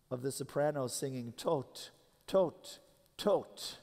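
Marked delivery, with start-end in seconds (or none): 1.3-3.8 three single words, each stepping up in pitch; higher and higher. Retold or imitated. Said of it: "higher and higher in pitch".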